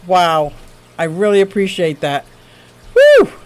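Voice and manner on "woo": high, loud whoop of joy; excited whoop. Real, not acted. Streamer whooping at a generous super chat, high and loud. Cheering at a big donation.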